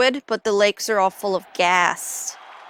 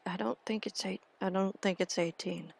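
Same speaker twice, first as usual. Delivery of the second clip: much softer, half to herself; muttered doubt; 15 dB quieter than usual. Retold or imitated. Doubting the site's count, very soft and muttered. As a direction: murmur the doubt under her breath. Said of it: "I was like".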